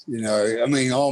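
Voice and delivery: ordinary talk, nothing marked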